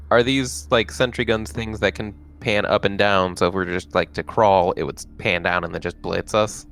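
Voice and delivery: normal talking voice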